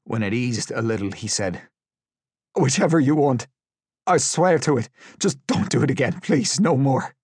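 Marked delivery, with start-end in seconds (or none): 2.5-7.1 high, loud pleading; frantic, pitched-up surrender; desperate begging. A narrator's reading. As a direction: plead to make it stop. Tortured prisoner begging captors.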